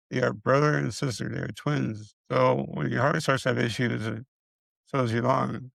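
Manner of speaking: ordinary talk, nothing marked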